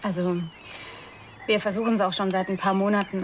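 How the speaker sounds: ordinary delivery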